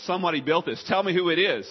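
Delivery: loud, high, insistent demand; demanding shout. Retold or imitated. Skeptic friend loudly demanding who built the model.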